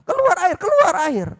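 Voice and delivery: high, loud, frantic cry; hammed-up panicked call; panicked shout. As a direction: shout at the rock for water. Moses strikes the rock, panicking.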